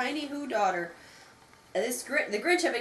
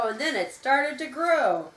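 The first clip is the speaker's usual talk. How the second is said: plain reading voice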